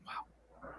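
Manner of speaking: breathless whispered wow; hushed amazement; awed whisper. Retold or imitated. Awed whisper after story.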